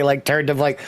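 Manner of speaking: ordinary talk, nothing marked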